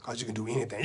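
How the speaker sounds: low, soft encouragement; hushed in the ear. Acted. Uncle encouraging nephew in his ear, hushed. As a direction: keep it down, close to his ear. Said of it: "Leaned over to my ear".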